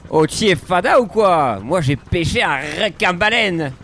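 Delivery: high, loud Marseille fisherman boast; Marseille accent. Acted. A friend tops the fish story, loud Marseille accent. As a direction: bellow it like a proud Marseille fisherman. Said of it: "la voix d'un pêcheur marseillais".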